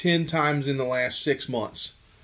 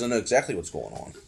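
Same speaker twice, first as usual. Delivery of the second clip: low, quiet, fast knowing voice; a low, fast, sly confidence; Sammy impression; 10 semitones lower, 8 dB quieter, faster than usual. Friend mimics the shopkeeper's low knowing reply. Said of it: "He goes".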